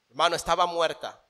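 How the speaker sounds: normal talking voice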